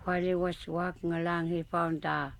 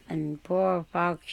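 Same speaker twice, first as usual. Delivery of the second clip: ordinary delivery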